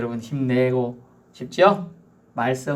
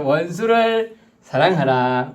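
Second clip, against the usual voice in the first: slow, 원수를 stressed, louder; slow emphatic proclamation; 3 semitones higher, 4 dB louder, slower than usual. Acted. Preacher restating the verse, slow and louder. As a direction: proclaim 원수를 사랑하라 firmly.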